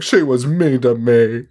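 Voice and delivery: slow, hammed-up tearful complaint; mock crying. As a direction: imitate a crying aunt, drawing it out. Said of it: "The aunt's crying, being like".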